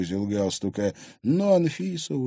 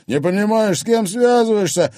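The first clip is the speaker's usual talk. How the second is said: high, loud menacing warning; angry threat; 12 semitones higher, 8 dB louder than usual. Acted. Thug warning girl who she's dealing with, angry. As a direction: warn her furiously. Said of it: "гневно взглянул на неё Оскар".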